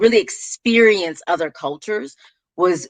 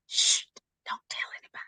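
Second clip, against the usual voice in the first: playful conspiratorial whisper, very soft; secretive whisper; 13 dB quieter, faster than usual. Pretend. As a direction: hush them and whisper it like a secret. Playfully sharing a little secret.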